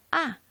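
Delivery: "아" short, falling from high; fourth Mandarin tone. Shown as a demonstration. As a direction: drop "아" sharply from the top. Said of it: "사성이 있다고 그러죠".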